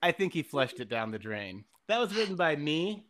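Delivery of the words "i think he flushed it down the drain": put-on reciting a childhood poem in rhyme; poem recital. Reading his third-grade poem to clear the air, a mock recital. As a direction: read it like a proud poet. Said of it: "and it goes like this".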